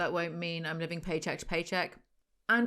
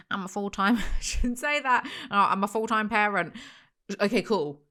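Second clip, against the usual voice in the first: usual speaking voice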